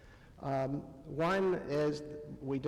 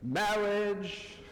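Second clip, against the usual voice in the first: lightly hammed-up formal ceremony opening, a bit higher; mock wedding officiant; 4 semitones higher than usual. Mocking the stock opening line, lightly pompous officiant. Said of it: "doesn't start out something like".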